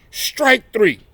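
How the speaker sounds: plain reading voice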